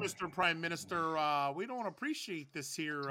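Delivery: lightly put-on Canadian accent. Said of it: "terrible Canadian accent".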